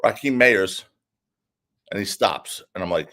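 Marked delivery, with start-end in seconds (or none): 0.0-0.8 lightly imitated court clerk, raised and louder; clerk's announcement. Clerk reading the verdict to the courtroom, mock announcement. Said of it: "announcement of the".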